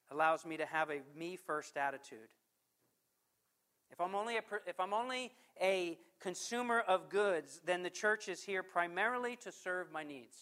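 normal talking voice

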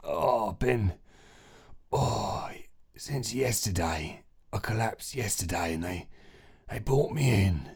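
groaning, faint patient voice; weak, sick son. Acted. Sick son telling mum he collapsed, weak and groaning. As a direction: answer feebly, with groans and pauses.

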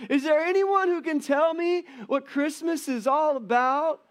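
high, loud, slow pleading question; desperate Charlie Brown. Retold or imitated. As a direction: plead like a fed-up cartoon kid. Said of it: "And then he says this phrase".

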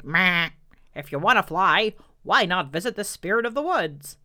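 put-on a loud knowing old sheep. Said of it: "an old sheep who knew about stuff said".

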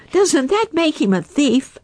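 high, loud angry otter snap; snapping. Acted. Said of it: "snapped Little Joe".

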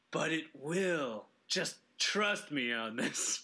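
lightly voiced wise game designer; Miyamoto impression. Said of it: "Shigi Miyamoto is like".

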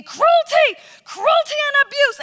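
woman shrieking at the chicken handler; screaming accusation. Retold or imitated. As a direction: shriek it like an outraged busybody. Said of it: "starts screaming at me".

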